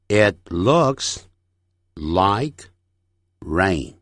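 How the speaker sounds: slow, with long pauses between words; careful, broken-up sentence drill; word-by-word model. Shown as a demonstration. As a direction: separate each chunk for learners. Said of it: "整句练习".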